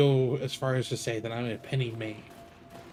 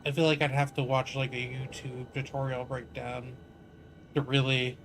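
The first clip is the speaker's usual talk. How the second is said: normal talking voice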